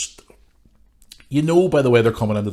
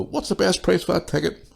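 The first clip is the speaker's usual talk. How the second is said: mock customer; 9 semitones higher than usual. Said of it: "People come into your shop and go".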